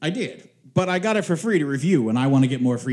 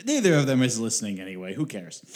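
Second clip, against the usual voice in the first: ordinary delivery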